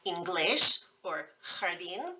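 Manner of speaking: inglés and jardín slowly, hit on the last syllable; slow down and land on GLÉS in inglés, DÍN in jardín; final-syllable stress. Shown as a demonstration. Teacher giving accented examples, final-syllable stress. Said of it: "if a word violates these patterns".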